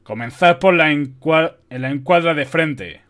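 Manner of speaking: loud general's command; commanding order. A narrator's reading. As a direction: order the troops forward with force. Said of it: "ordenó tomando la espada".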